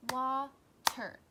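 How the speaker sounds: "Water" in two loud, separate beats; split into syllables. Shown as a demonstration. Teacher confirming two syllables, "Water" loudly broken in two. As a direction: punch wa, pause, then ter in "Water". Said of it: "That's two syllables".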